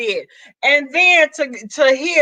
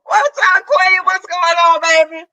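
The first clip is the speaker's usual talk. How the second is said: bright, higher hello; excited greeting; 5 semitones higher, 3 dB louder than usual. Real, not acted. Greeting Fi Babe, excited and higher. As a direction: greet her with glee.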